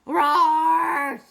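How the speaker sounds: loud, high sea monster roar. A narrator's reading. Sea monster answers the princess, loud roar. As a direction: roar back defiantly like a beast. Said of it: "said the sea monster".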